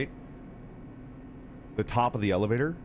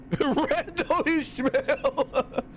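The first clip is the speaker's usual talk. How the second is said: laughing; 13 semitones higher, much slower than usual. Pretend. Laughing pirate name bit.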